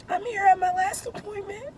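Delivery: strained, high, cracked voice; lost voice. Real, not acted. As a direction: push words out through a failing voice. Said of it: "I can't talk".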